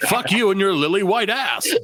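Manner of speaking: hammed-up customer insult; mock rude customer. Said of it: "This customer said".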